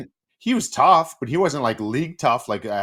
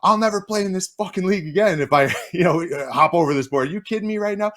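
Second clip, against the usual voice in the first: frantic, much higher and louder outburst; panicked disbelief on the bench; losing my mind; 9 semitones higher, 4 dB louder than usual. Retold or imitated. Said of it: "losing my mind on the bench".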